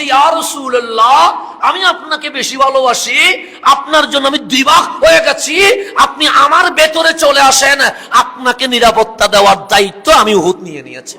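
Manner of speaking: high, very loud impassioned shout; crying out. Acted. Mountain Uhud offers the Prophet shelter, crying out loudly. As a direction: shout it as a mountain pleading, easing down in pitch at the end. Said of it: "চিৎকার করে বলছে".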